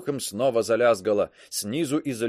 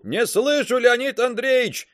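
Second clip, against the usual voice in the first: through a tube; 10 semitones higher than usual. A narrator's reading. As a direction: call back down the tube. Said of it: "отозвалась трубка".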